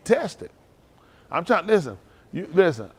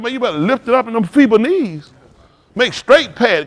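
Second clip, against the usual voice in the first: preached command; 6 semitones higher, 9 dB louder than usual. Retold or imitated. Preacher voices priest's command.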